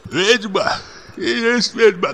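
high, tearful cry of accusation. A narrator's reading. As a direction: cry it out, near tears. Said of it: "глухим, плачущим голосом".

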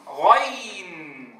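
غين drawn out very slowly; teacher reading غين slowly for students; model letter name. Shown as a demonstration. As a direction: stretch غين long and steady. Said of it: "تعالوا لنقرأه".

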